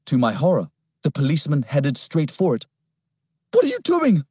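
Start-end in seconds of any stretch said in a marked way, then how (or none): 3.5-4.2 put-on very high, alarmed boy's cry; panicked gasp. A narrator's reading. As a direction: gasp it out in horror. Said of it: "I gasped".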